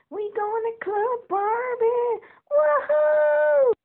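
high, slow, loud party whoop; big high whoop of excitement; excited cheer. Acted. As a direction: cheer like you're off to the club. Doll cheering on way to club.

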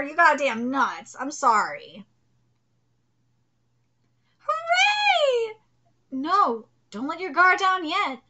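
ordinary delivery

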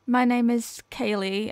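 ordinary talk, nothing marked

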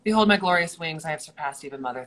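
loud, fast grandiose boast; pompous vain hero. Acted. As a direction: proclaim your wings, full of yourself. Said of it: "What's my wings line?".

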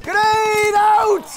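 high, drawn-out battle yell; cover me shout. Real, not acted. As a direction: bellow the warning to the squad. Calling out a grenade throw.

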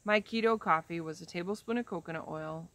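ordinary delivery

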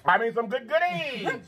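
high, teasing sing-song; playful high voice. Real, not acted. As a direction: tease it up high about the goodies. Playful talk about treats.

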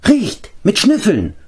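very high, squeaky creature voice, drawn out; high-pitched, stretched-out animal chatter; excited creature Gorgi. A narrator's reading. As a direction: squeal it like an eager little beast.

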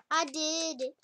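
ordinary talk, nothing marked

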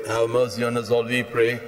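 normal talking voice

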